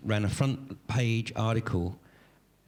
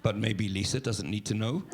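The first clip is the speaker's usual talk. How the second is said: put-on Swedish accent. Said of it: "this is my Swedish accent".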